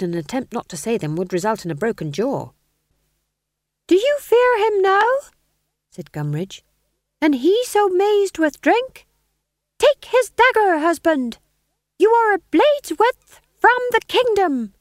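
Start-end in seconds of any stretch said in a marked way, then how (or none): 3.9-5.1 high, loud taunting wife; scheming wife voice. Acted. Gumridge as Lady Macbeth. 7.2-14.7 high, loud taunting wife; scheming wife voice. Acted. Gumridge as Lady Macbeth.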